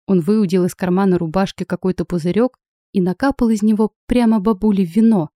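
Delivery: ordinary delivery, nothing marked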